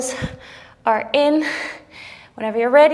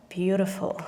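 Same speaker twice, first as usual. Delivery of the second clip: whispered; 4 semitones lower, 5 dB quieter than usual. Real, not acted. Whispered praise mid push-up.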